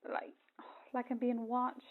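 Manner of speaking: slow, whispered unease; frightened whisper. Acted. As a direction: whisper it as if someone's near. Scared woman whispering on phone.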